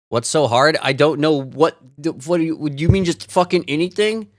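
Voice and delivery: exasperated loud disbelief. Real, not acted. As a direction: vent at a silly question. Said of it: "exasperated rant at the prompt".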